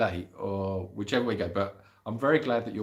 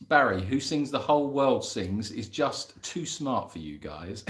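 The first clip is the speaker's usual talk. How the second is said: ordinary talk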